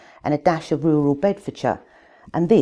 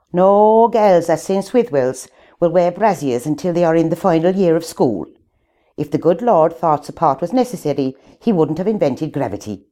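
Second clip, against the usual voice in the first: louder, laying down convent rules; strict nun lecturing; 6 dB louder than usual. Retold or imitated. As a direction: boom the rules at a hall of girls. Nun's talk on life quoted.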